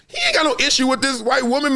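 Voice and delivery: usual speaking voice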